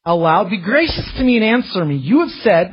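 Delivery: fervent prayer voice rising high; impassioned plea. Acted. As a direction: cry out the psalm with fervour. Psalmist crying out to God.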